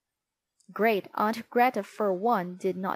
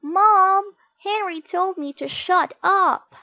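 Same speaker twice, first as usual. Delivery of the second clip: high, loud whiny tattle; tattling child; 10 semitones higher, 8 dB louder than usual. A narrator's reading. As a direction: tell on your brother in a whine. Peter tattling to Mom.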